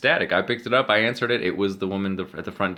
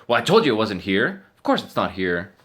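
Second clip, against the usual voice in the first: retold angry I-told-you-so, louder and higher; mad; 4 semitones higher, 6 dB louder than usual. Neighbor woman snapping at the phone owner, mock angry. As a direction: scold him for doubting her. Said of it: "she was mad".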